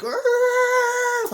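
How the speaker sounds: high, loud scream of alarm; startled scream at the wheel; startled yell. Retold or imitated. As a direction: jolt awake screaming. Startled awake driving.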